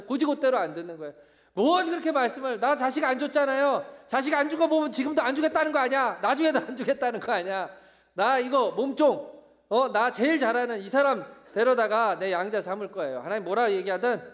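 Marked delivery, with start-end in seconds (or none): 1.5-13.2 loud, high, indignant tirade; hammed-up furious protest; snapping back angrily. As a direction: fire complaints straight back at God. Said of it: "아브라함이 하나님을 쏘아붙이는".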